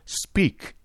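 a sharp, breathy, high-pitched order; menacing command. Acted. Detective looming over the silent suspect, menacing. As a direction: force it out as a threat. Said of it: "he cried menacingly".

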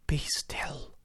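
urgent breathless whisper; pleading whisper. Acted. Narrator begs the raving curate to stay silent, whispered plea. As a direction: beg him to hush, voice only breath. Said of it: "I implored".

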